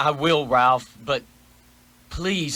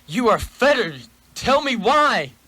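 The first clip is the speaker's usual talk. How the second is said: alarmed shout; 7 semitones higher, 3 dB louder than usual. Acted. Scrooge alarmed at chains.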